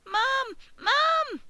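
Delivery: very high frightened cry of Mum; terrified shout. Acted. Piglet waking from nightmare calling mother, terrified high shout. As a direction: scream for mother in terror. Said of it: "shouted Babe in terror".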